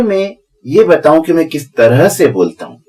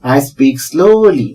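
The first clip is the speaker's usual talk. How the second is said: very slow and drawn out; acting out speaking slowly; slow demo; 2 semitones higher, much slower than usual. Shown as a demonstration. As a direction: stretch out every word of "I speak slowly". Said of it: "जैसे ही मैंने कहा".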